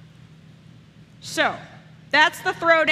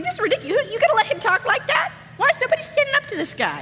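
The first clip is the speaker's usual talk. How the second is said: much higher, fast indignant outburst; outraged teen David; 10 semitones higher, faster than usual. Retold or imitated. Teen David protests to everyone at Goliath's taunt, high and fast. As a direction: fire it off like an outraged teenager, pitch way up. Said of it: "He's like".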